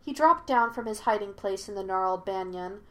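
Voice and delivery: usual speaking voice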